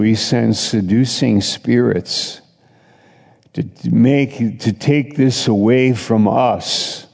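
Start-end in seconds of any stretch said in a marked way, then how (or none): none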